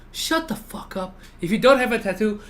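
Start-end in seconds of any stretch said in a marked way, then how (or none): 0.1-1.1 high, slow mock snap; teasing tattooed viewers with a mock snap. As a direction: snap it with a jokey sneer.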